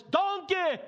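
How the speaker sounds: enraged cartoon yell at a donkey; Shrek. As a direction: bellow at the donkey like a cartoon ogre. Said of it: "I can hear Shrek's voice".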